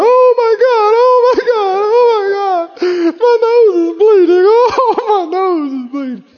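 high, loud, hammed-up wailing; mock crying. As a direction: whine like a big baby over a bloody nose. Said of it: "roll over on the floor and go".

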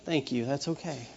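gentle dad reassurance. Retold or imitated. Dad soothing his guilty child, soft and tender. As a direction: hush it kindly like a forgiving father.

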